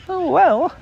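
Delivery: high, meek little voice; meek Piglet. A narrator's reading. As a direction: shrink back, squeak it meekly.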